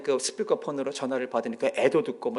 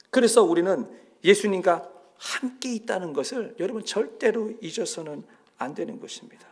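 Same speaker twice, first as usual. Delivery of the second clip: usual speaking voice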